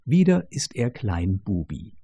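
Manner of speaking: ordinary delivery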